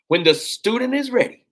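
high, loud proclaiming; ringing preacher call; preaching proclamation. Real, not acted. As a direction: announce it like a revelation. Preacher proclaims favourite proverb.

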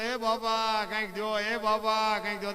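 slow, drawn-out begging call; a lightly voiced beggar asking passers-by; beggar's plea. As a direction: imitate a beggar pleading for alms. Narrator voices a street beggar.

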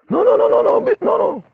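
very high falsetto string of refusals; Viva. Retold or imitated. Viva refusing to let him accept, mimicked falsetto protest.

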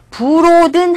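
"broaden" drawn out as "bu-ro-deun"; Koreanized "broaden" with an extra vowel after b and a round o; wrong Korean-style pronunciation. Shown as a demonstration. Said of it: "broaden 하지 마세요".

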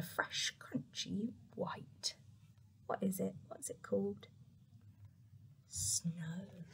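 whispered question and answer; hushed wonder. A narrator's reading. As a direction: whisper it like a secret. Whispering the snow reveal to children.

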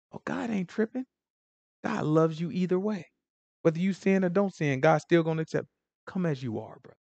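soft, casual soothing; mock easygoing reassurance. Comforter telling a struggling sinner not to worry, mock easygoing reassurance. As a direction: play the lenient pal, hushed and easy.

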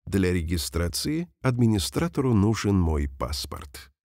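normal talking voice

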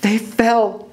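high, suspenseful statement; high storyteller's cliffhanger; dramatic narration. A narrator's reading. First telling of the fall.

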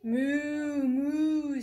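long, loud cow's moo. Acted. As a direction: moo the words out like a cow. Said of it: "said the cow".

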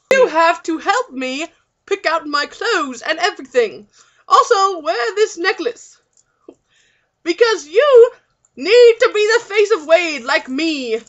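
bad British accent, a little Australian; Hugh Grant. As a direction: boss her about in mock British. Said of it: "I'm Hugh Grant".

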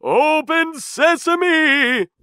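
high, slow, booming shout; greedy man's eager magic call; loud cry. A narrator's reading. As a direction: shout the spell, stretching it out. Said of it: "he cried".

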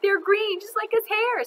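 put-on very high, loud kid's taunt; teasing. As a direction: pile on with a high-pitched jeer. Fatso joins the teasing.

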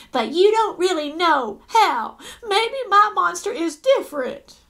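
put-on girl arguing with her brother, eager and hopeful; hopeful insistence. A narrator's reading. Marilyn argues with brother.

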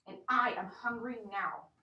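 loud, angry roar; hungry wizard roars. Acted. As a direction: roar it back at him. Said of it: "roared the wizard".

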